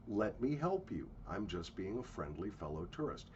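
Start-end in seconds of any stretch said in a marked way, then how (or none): none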